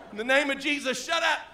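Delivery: high-pitched mock yell; retold scream. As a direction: re-enact the yelled rebuke.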